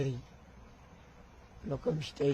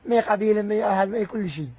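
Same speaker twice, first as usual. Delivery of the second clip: loud, high defiant outburst; defiant shout; 5 semitones higher, 6 dB louder than usual. Real, not acted. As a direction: fling it back proudly. Defiant claim of tribe. (صيحة تحدٍّ)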